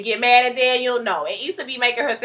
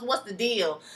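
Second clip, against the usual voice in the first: ordinary delivery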